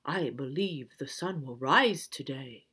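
low, soft owl voice; low hushed owl. Acted. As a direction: answer like a calm old owl. Owl speaks.

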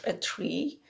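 ordinary delivery

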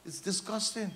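normal talking voice

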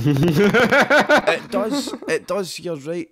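laughing out loud while agreeing. Real, not acted. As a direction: burst out laughing as you say it. Laughing agreement at Legolas joke.

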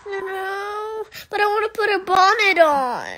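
loud crying, fast. Real, not acted. Child crying to mom about a bonnet. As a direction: blurt it through sobs.

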